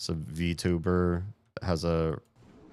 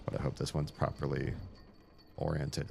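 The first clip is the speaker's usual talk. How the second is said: ordinary delivery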